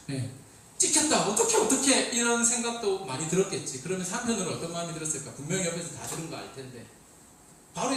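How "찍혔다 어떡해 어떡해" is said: high, loud flustered panic; mock panic. Server fretting over being overheard, high mock panic. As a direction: fret like you just got caught.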